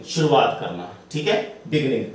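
ordinary delivery

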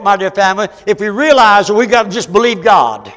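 high, loud impassioned pleading to God; preaching at full force. Real, not acted. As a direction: cry out to heaven from the pulpit.

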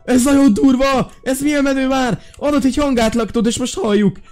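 high, loud excited outburst; thrilled, loud and high; excited shouting. Real, not acted. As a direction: shriek with delight at the new voice. Voice changer makes him sound funny.

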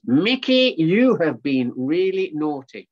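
loud, slow, drawn-out telling-off; scolding. Acted. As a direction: scold a naughty pet, stretching the words. Owner tells off monkey.

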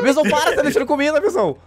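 high squealing laugh-talk; scolding the pet while laughing. Real, not acted.

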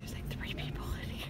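hushed whisper so nobody hears. Real, not acted. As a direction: whisper it under your breath. Hushed remark in restaurant.

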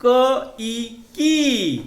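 की said with a drawn-out ई; long ई vowel. Shown as a demonstration. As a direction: stretch the ई in की a little longer. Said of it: "आता याचा उच्चार थोडा लांब करायचा".

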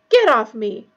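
loud, forceful disgusted order; spat out. A narrator's reading. Harry throwing Pettigrew's hands off, spitting it. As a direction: spit it out hard. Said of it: "Harry spat".

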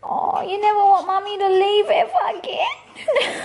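high, doting mummy voice; pet-talk voice. Real, not acted. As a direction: coo to an excited dog. Talking to her excited dog.

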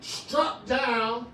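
ordinary delivery

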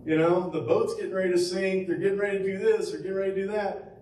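high, fast mock panic from Peter; a touch of a screaming Peter, high and hurried; mock panicked scream. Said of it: "just screaming like a girl".

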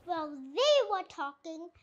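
ordinary delivery, nothing marked

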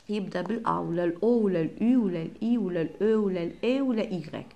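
normal talking voice

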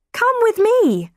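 high-pitched, insistent summons; insistent command. A narrator's reading. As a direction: order someone to come along at once. Nurse takes the father's wrist.

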